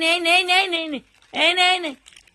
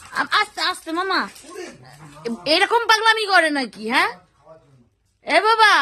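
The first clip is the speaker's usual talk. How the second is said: coaxing pet call. Real, not acted. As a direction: call the little one over sweetly. Calling a pet bird over.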